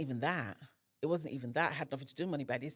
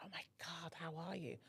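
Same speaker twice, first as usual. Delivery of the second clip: hushed, whispered mock gush; whispered greeting; 15 dB quieter than usual. Woman greeting someone she hugs, in an imitated whisper. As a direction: whisper it, imitating an effusive hello. Acted gushing greeting from former foe.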